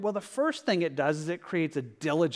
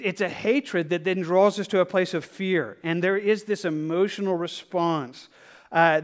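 usual speaking voice

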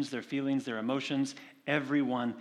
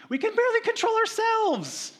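sudden high squeaky voice; mock-helpless squeal about barely coping; high squeak; 7 dB louder than usual. Preacher mocks own helplessness.

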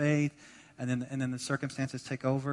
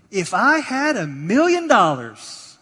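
loud, high, drawn-out wishing; exaggerated wishful exclamation; 7 semitones higher, 14 dB louder, slower than usual. Pastor quoting people's big daydream to congregation, loud exaggerated wishing. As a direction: belt out the daydream, stretching it slowly. Acts out a common wishful line.

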